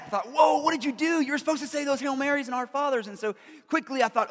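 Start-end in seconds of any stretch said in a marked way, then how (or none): 0.3-3.1 high, loud "what have you done" panic; alarmed self-scolding. Retold or imitated. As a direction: scold yourself in sudden alarm, voice jumping up. Scolding himself in retold thoughts.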